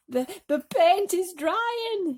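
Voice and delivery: high, loud, slow moaning. Acted. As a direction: moan it in misery. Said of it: "he moaned".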